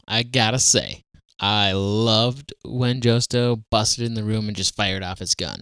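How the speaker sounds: ordinary talk, nothing marked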